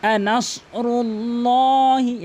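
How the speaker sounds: slow আনাসরুল্লাহি, heavy "llaw" held long; আনাসরুল্লাহি drawn out, rounded "law"; correct recitation. Shown as a demonstration. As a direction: chant আনাসরুল্লাহি with full s and dark l. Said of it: "এভাবে উচ্চারণ করতে হবে".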